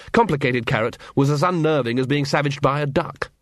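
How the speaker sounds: ordinary delivery, nothing marked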